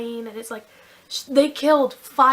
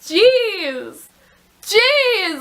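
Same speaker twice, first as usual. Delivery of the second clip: laughing exclamation; 8 semitones higher, 5 dB louder than usual. Real, not acted. Reacting to shocking book twist.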